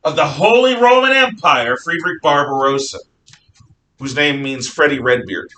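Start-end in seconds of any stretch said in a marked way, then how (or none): 0.0-3.0 booming, raised-pitch proclamation; grand announcement. Acted. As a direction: proclaim it like a royal herald. Grandly announces the emperor's title.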